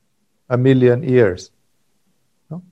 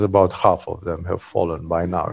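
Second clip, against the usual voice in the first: a lightly voiced old ascetic, lower and softer; low, hushed ascetic's voice; 9 semitones lower, 4 dB quieter than usual. Hairy ascetic tells Indra his secret, low and hushed. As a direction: let the line drop low and quiet. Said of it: "and he says".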